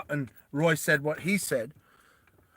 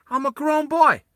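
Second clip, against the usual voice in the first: high, loud put-on kid voice; 11 semitones higher, 6 dB louder than usual. Shown as a demonstration. Player mocking his character, high childish voice. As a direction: pitch it way up and whine it out.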